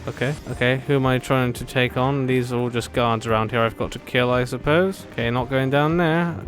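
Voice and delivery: ordinary talk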